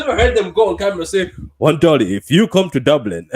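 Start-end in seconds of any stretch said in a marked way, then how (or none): 1.6-3.3 laughing. Retold or imitated. Laughing mock quote, loud.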